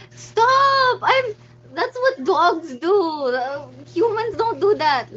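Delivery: loud, high, laughing stop; laughing protest. Real, not acted. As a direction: scold the chat through laughter. Laughing protest at chat joke.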